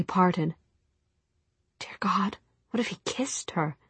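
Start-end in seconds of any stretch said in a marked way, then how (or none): 1.8-3.8 hushed, half-whispered worry; soft private whisper; anxious inner thought. A narrator's reading. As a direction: drop to a whispered aside of dread. Narrator voices Tessa's dread thought.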